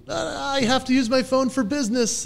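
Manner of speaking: high-pitched mock excuse; defensive protest. Husband defending his phone use to his wife, defensive. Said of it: "And I'm like".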